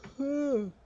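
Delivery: long audible yawn; a real sleepy yawn. Real, not acted. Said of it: "I am sorry I'm yawning so much".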